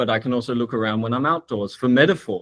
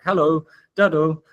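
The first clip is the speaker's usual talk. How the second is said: "hello" lilted higher, echoed as "da, do"; universal greeting melody; 5 semitones higher than usual. Shown as a demonstration. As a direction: lilt "hello", then repeat its tune wordlessly. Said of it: "some common kind of universal melodies".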